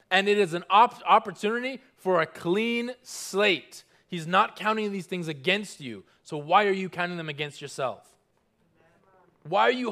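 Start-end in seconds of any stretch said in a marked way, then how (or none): none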